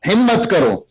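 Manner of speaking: high, strong exhortation; rousing call with force; firm rousing call. Shown as a demonstration. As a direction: push them forward with it. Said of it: "مٹھی بند کر کے مضبوطی کے ساتھ".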